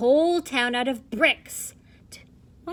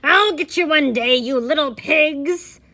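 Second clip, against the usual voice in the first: angry shout; 4 semitones higher, 10 dB louder than usual. Acted. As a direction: shout the threat at the pigs. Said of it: "the angry wolf shouted".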